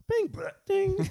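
sung high train door chime; slow, high ding-dong imitation.

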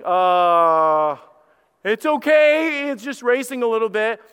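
high, slow, forced-casual reassurance; mock-calm dad covering his fear; playing it cool. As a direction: pretend nothing's wrong while panic leaks through. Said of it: "I'm trying to play it all cool".

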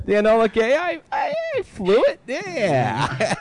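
put-on proud old bomber pilot brag; old-timey announcer voice. Continues old war vet bit.

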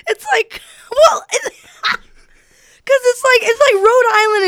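high, laughing words; real laughter breaking the words. Real, not acted. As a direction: talk through the giggles. Laughing through high speech.

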